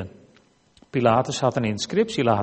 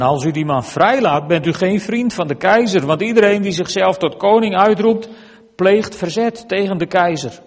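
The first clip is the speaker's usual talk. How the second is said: raised, louder reading voice; crowd calling out; 7 semitones higher, 7 dB louder than usual. A narrator's reading. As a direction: call it out insistently as an accusing crowd. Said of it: "Maar de Joden riepen". (luid roepende menigte, voorgelezen)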